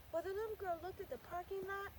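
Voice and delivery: ordinary delivery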